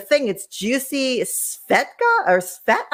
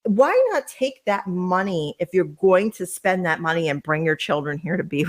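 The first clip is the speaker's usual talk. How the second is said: normal talking voice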